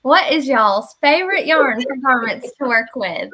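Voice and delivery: lively Southern US accent, played up for the room. Real, not acted. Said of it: "that's how I talk too".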